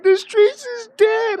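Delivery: high, slow mocking whine; lightly mocking complaint; mock Lonnie. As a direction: mimic him high and drawn out.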